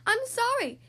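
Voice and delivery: high, loud flustered apology; sputtered apology. Acted. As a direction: blurt it as a scared dragon. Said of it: "he sputtered".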